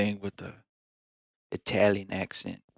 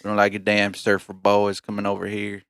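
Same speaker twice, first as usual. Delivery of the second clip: put-on grouse in a cowboy accent; 3 dB louder than usual. Scorning surfer boys, drawling cowboy accent. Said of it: "a cowboy accent".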